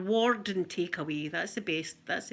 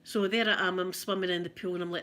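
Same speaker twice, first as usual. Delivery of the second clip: normal talking voice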